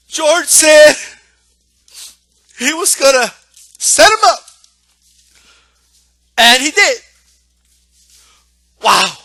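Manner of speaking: loud, high, hammed-up tearful choke; mock crying. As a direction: imitate a commentator blubbering in awe. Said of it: "and started to cry".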